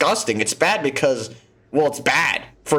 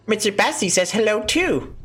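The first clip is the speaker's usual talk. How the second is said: put-on higher, childish voice for a toy; 6 semitones higher than usual. Voicing a little girl introducing her plush toy, high and childish. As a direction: pipe it up like a small child.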